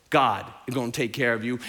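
low, steady quoted promise; low reassurance. Acted. Reassuring Israelites fleeing Pharaoh, low and steady. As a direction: say it low and assured.

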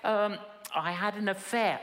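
ordinary talk

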